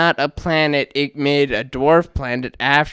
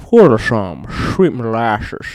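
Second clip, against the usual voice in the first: put-on slow, thick southern accent, mouth full of molasses; Andy Bernard southern drawl; 4 semitones lower, 4 dB louder than usual. Host imitating a sitcom character, slow southern accent. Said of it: "talk kind of southern accent".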